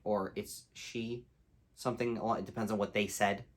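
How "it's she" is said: soft, offhand correction; casual, polite. Retold or imitated. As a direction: slip in a polite correction, softly. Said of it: "very casually and politely say".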